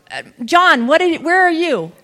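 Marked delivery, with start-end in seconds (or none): none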